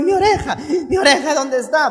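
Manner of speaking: high, comic frantic wail; hammed-up distress, half laughing; mock panic. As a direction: play a man frantically hunting for something lost. Injured Malco hunting his ear.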